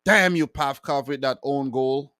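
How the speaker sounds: ordinary delivery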